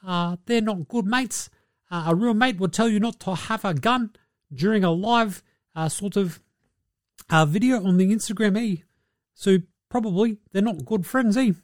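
a hint of laid-back New Zealand big man; mock New Zealand accent. Steven Adams warning Ja about his crew, mild New Zealand accent. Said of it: "And he's like".

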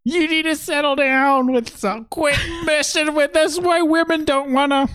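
very high, squawking falsetto mom; sitcom mom. Acted. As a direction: scold like a hammed-up falsetto mother. Joining the sitcom mom bit.

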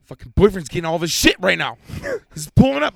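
loud panicked rush. Retold or imitated. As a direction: blurt it out in panic. Panicked retelling, boyfriend arriving.

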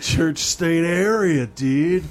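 lazy surfer-stoner delivery; Shaggy voice. Acted. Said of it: "they had Shaggy reporting this story".

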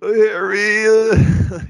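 put-on high, whiny, cracking teen voice; whiny Ron voice. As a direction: whine his name like a scared kid. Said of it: "that whiny voice he did".

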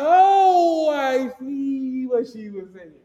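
sheepish, stretched-out realisation; dawning realisation. Retold or imitated. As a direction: let the penny drop slowly, trailing down. Acting out his embarrassed realisation.

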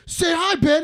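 loud, high-pitched mock greeting; imitated excited shout. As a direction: call it out like a retold prank. Re-enacts viral video prank greeting.

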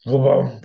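loud revving vroom; engine vroom. Shown as a demonstration. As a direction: rev it up like an engine. Sound effect of brain.

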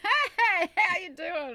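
high, loud, over-bright hello; put-on cheery greeting. Greeting a disappointing date, put-on high cheery voice. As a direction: greet them high to cover the shock. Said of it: "I've learned to be like".

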